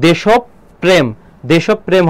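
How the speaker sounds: দেশপ্রেম as 'deshop-prem', doubled p; সঠিক উচ্চারণ. Shown as a demonstration. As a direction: slowly split দেশপ্রেম after deshop, then join it. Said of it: "এখানে দেখো উচ্চারণটা হবে". (দেশপ্রেম-কে 'দেশোপ্-প্রেম')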